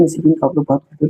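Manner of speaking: ordinary talk, nothing marked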